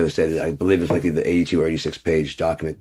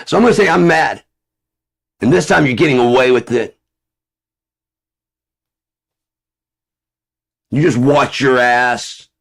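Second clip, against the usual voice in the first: angry dumb guy; 7 semitones higher, 5 dB louder than usual. Pretend. Mocking an ignorant guy who is angry without knowing why, high loud rant. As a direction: shout it like an ignorant hothead.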